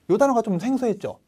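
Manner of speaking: ordinary talk, nothing marked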